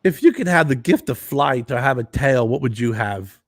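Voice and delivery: odd halting star cadence; Christopher Walken impression. Walken posing Jay a strange question, halting impression. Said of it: "He goes".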